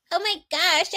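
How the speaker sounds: high, breathless imitation of her; mock giggly girlfriend. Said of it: "And she just laughing".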